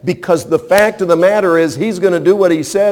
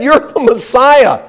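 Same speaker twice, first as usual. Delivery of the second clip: higher, insistent mock scolding; a higher, pushy rebuke, lightly voiced; Peter rebuking; 6 semitones higher than usual. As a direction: play the disciple pleading with his master not to talk of dying. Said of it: "He said".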